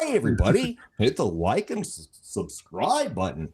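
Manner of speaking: guest redoing the plug as Goofy. Shown as a demonstration. Goofy greeting dropping off. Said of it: "retake of the Goofy plug".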